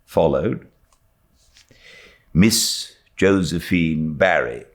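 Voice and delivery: ordinary delivery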